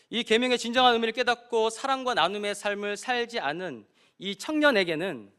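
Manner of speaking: loud, high impassioned preaching; full-force preaching. Acted. Pastor urging the congregation, loud and fervent. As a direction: drive the point home to the congregation.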